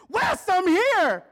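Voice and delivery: high, drawn-out squeal of a greeting; excited shout. Acted. Cousin bursting in on Wes, excited shout. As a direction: yell it high and long, announcing yourself.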